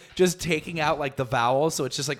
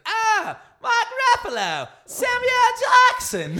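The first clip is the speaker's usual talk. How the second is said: put-on very high, shouted Australian accent; squealed, over-the-top Australian accent; Chris Hemsworth; 4 dB louder, slower than usual. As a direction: holler names in exaggerated Australian. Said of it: "it's 'cause of his accent".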